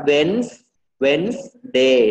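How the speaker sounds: "Wednesday" as WENZ, then WENZ-day; correct Wednesday. Shown as a demonstration. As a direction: model "Wednesday" slowly in two beats. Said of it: "เราอ่านว่า Wednes".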